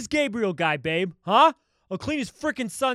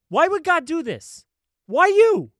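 angry; 7 semitones higher, 5 dB louder than usual. Acted. Jealous fiancé quarrel.

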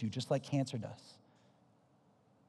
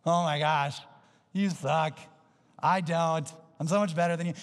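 loud, hammed-up sneering judgment; exaggerated, superior put-down; mock scorn; 4 semitones higher, 6 dB louder than usual. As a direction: play a smug judge, punching each phrase. Mocking a judgmental group member.

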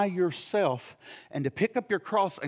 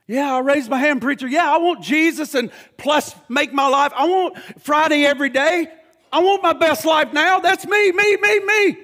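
whiny mock voice climbing higher and louder; mocking selfish believer; 7 semitones higher, 8 dB louder than usual. Preacher mocking a selfish churchgoer, whiny and rising. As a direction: ham up a spoiled churchgoer's demands.

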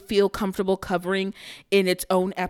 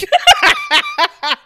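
high cackle; 7 dB louder than usual. Real, not acted. Laughing at own joke.

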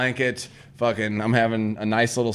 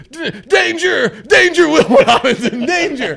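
mock alarm; 8 dB louder, slower than usual. Mock alarm about being alone.

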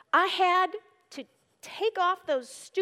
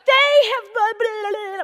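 loud, high, hammed-up venting; mock angry prayer; 6 semitones higher, 11 dB louder than usual. Retelling her frustrated prayer, mock angry shout. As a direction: rant at God, half joking. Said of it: "I was like".

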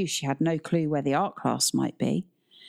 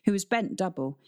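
usual speaking voice

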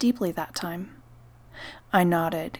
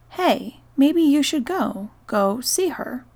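gentle suggestion; 7 semitones higher, 6 dB louder than usual. Shown as a demonstration. To the spirit, the kinder way: gentle raised suggestion. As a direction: lift the pitch and coax kindly. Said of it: "More like".

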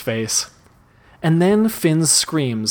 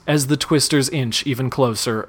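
ordinary delivery, nothing marked

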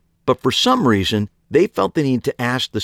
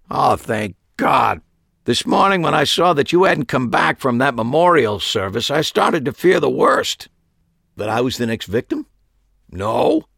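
higher, lightly voiced stern old shopkeeper; a hint of a fussy old magician lecturing; old uncle, cranky magician; 6 semitones higher than usual. A narrator's reading. Harry voiced in story.

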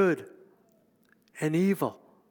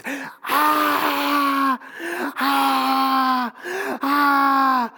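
three piercing screams, much higher and louder; girl screaming; 11 semitones higher, 8 dB louder, much slower than usual. Retold or imitated. Girl at the back of the retreat room screaming in terror. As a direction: shriek in sudden terror. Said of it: "this girl screams".

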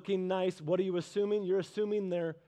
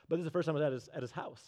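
ordinary talk, nothing marked

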